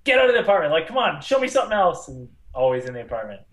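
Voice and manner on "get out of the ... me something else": higher, louder impatient plea; retold impatient complaint; exasperated viewer. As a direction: heckle the screen, fed up. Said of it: "then you'd be like".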